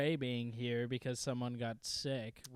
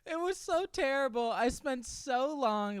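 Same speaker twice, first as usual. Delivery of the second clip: exasperated whine; 10 semitones higher, 3 dB louder than usual. Real, not acted. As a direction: drag it out, fed up. Complaining about the grind.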